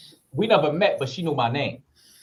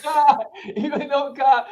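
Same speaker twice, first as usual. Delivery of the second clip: slow, higher words through real laughter; cracking up mid-story; laughing; 13 semitones higher, slower than usual. Real, not acted. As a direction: laugh your way through the line. Laughing while telling the story.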